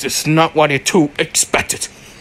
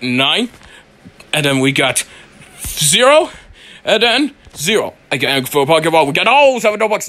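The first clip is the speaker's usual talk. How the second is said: ordinary delivery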